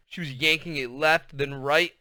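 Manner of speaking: ordinary delivery, nothing marked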